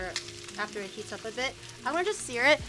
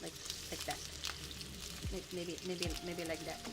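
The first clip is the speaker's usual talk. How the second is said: low, soft muttering to oneself; quiet murmur; 6 semitones lower, 13 dB quieter than usual. Real, not acted. Cook arranging chicken in the pan, quiet murmur. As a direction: murmur it low, barely projected.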